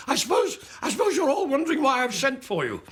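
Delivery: high-pitched, panicked stage opener; strained high voice of stage fright; terrified comic. Retold or imitated. Nervous comic opening to a Glasgow audience, terrified. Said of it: "It's just sheer terror".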